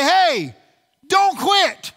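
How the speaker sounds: high, loud plea not to quit; urgent encouragement. Pretend. Pastor voicing a friend urging a discouraged one, urgent. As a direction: urge a struggling friend to keep on.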